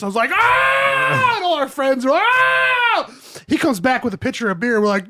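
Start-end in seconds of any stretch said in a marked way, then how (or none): 0.3-1.4 high loud "Ah!" of shock; shocked shout. Retold or imitated. Retelling his reaction to the wife, shocked high yell. 2.1-3.1 shocked shout. Retold or imitated. Retelling his reaction to the wife, shocked high yell.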